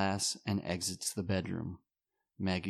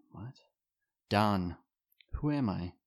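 ordinary delivery

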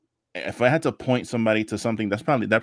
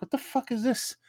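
mock bewildered; 5 dB quieter than usual. Retelling own confused reaction.

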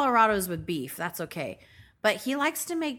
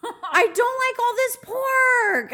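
put-on high, slow whiny little-girl voice; whiny pout; 13 semitones higher, 4 dB louder, slower than usual. Girlfriend whining to her boyfriend about pork, high and slow. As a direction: pout it out like a spoiled kid. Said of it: "I get all upset".

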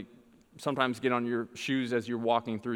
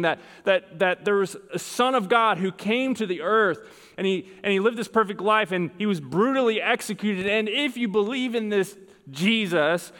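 higher, louder sermon voice; emphatic preaching; 8 semitones higher, 5 dB louder than usual. Real, not acted. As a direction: preach it out with force. Preaches with rising force.

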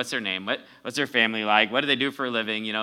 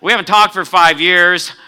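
higher, louder mock indignation; mock indignant; 6 semitones higher, 13 dB louder than usual. A preacher mocking his friend for not saying hello, indignant. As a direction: act offended at him skipping the greeting. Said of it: "It's like".

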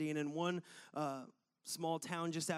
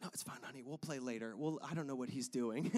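soft mock mom voice; 6 semitones lower, 5 dB quieter, faster than usual. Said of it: "moms are like".

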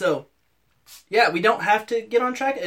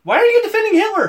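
high, fast, loud accusation; mock outrage; 6 dB louder, faster than usual. Co-host accusing the host in comic quarrel, mock outraged. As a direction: accuse them in exaggerated disbelief.

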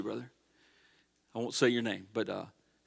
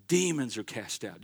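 ordinary delivery

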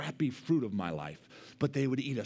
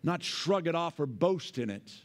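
preaching; 5 semitones higher than usual. Acted. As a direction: preach it strongly. Preaching at full force.

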